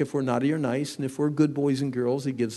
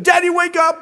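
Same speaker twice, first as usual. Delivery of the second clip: put-on high, loud little boy calling; child shouting; 11 dB louder than usual. Small son shouting at dad in the night, high and loud. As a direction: shout like a three-year-old waking his dad.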